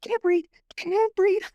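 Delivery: high, slow gasping panic; imitated breathless panic; panicked. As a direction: struggle to get the words out between breaths. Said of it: "I'm looking at her like".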